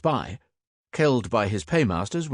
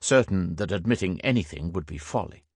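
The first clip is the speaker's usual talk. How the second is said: ordinary delivery, nothing marked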